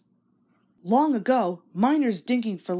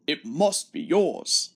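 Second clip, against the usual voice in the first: low, grumbling big-engine grunt; gruff engine grumbling at a teasing rival; grunting Gordon; 4 semitones lower, slower than usual. Acted. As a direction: grumble it out like a sulky heavy engine. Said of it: "grunted Gordon".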